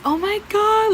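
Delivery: high-pitched whiny imitation of crying; put-on distraught squeal; mock crying. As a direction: mimic an upset girl wailing on the phone. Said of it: "crying on the phone".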